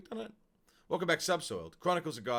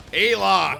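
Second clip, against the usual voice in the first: loud, raised call of a name; hailing shout; 6 semitones higher, 14 dB louder than usual. Real, not acted. Streamer greets returning subscriber, loud hail. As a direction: shout out a welcome.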